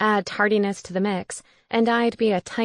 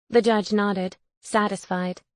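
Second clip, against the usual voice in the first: usual speaking voice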